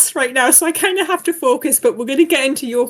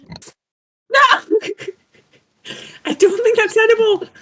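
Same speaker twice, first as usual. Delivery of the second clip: laughing protest; 9 semitones higher, 5 dB louder than usual. Real, not acted. Player dismayed, laughing cry.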